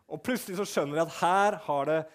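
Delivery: ordinary talk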